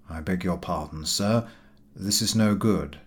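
a polite waiter, voiced low and soft; polite, faintly amused refusal; smiling waiter. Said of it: "He smilingly replied".

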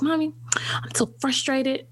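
whispery three-year-old impression; imitating frustrated toddler. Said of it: "I'll never forget my baby".

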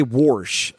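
"warsh" said with an added R; wash with an R. Shown as a demonstration. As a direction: slip an R into "warsh" before the sh. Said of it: "We do not say warsh".

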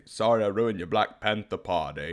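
lightly voiced Forrest Gump line; mom's gentle Forrest Gump impression; Forrest Gump quote. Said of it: "from Forrest Gump".

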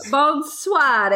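French greeting with an Italian lilt; Italian-sounding French. Pretend. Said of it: "That sounded Italian".

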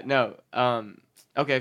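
ordinary talk, nothing marked

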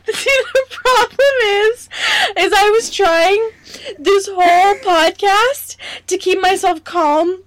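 high, teary laughter in the voice; struggling through helpless laughter; laugh-crying. Real, not acted. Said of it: "keep myself calm and cool and collected".

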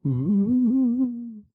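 high, loud ghostly ooh; ghost noise. Shown as a demonstration. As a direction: moan it like a ghost. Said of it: "I'll make some ghost noises".

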